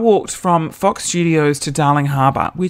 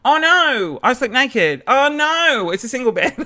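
high, drawn-out fake "oh no"; mock dismay; 9 semitones higher than usual. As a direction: pretend to be shocked, hammed-up. Feigned dismay in seduction scenario.

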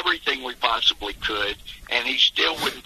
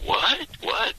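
baffled; 11 semitones higher than usual. Retold or imitated. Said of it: "people going".

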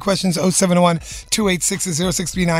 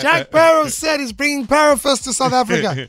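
excited shout; 7 semitones higher than usual. Real, not acted. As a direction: shout the big news to everyone. Host announcing the exclusive news.

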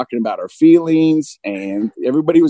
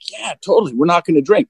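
higher-pitched, lightly acted eager agreement; mirror's reply; 5 semitones higher than usual. Mirror agreeing to the man's pact, lightly acted assent. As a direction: agree readily as the reflection. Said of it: "the mirror would be like".

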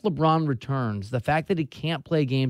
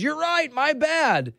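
much higher, put-on celebrity voice; famous celebrity's voice; 14 semitones higher, 3 dB louder, much slower than usual. Celebrity answering the woman who seats diners, put-on famous voice. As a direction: pitch it way up as the famous guy conceding. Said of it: "in his very famous voice".

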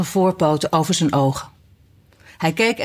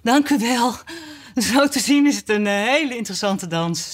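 higher-pitched, polite thanks; relieved politeness; 5 semitones higher than usual. A narrator's reading. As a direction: thank them brightly, glad it is over. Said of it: "dolblij dat het eindelijk afgelopen was".

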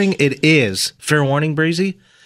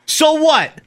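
pitched-up gangster retort; defiant mobster; 5 semitones higher than usual. Acted. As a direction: throw it back as a challenge. Acted mobster quarrel.

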